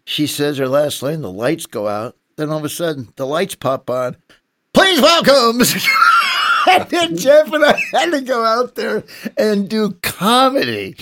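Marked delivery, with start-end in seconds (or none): 4.7-5.6 loud, high mock announcer; mock announcer call. Lights pop on, comedians announced. 5.6-6.6 high shrieking laugh; burst of laughter. Real, not acted. Laughing at awkward comedy entrance. 6.7-11.0 loud, high, laughing through the words; laughing loudly. Real, not acted. Laughing while retelling awful moment.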